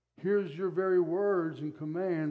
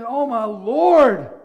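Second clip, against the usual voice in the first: loud, slow, frightened cry of Oh, my Lord; terrified cry; 4 semitones higher, 10 dB louder, much slower than usual. Acted. Servant sees the army and cries out in terror. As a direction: gasp it out in fear at the army. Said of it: "he just becomes so afraid".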